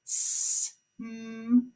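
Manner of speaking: soft stretched segmenting; sounds stretched apart. Shown as a demonstration. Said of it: "Say it".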